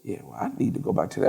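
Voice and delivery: low, soft, breathy retreat; hushed plea. Retold or imitated. Teller begging to return to the airport, low hushed voice. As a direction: whisper you want to go back. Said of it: "I'm like".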